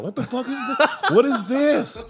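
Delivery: father-in-law shocked by the cake; hammed-up baffled old man; father-in-law spazzing out. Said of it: "father-in-law spazzes out".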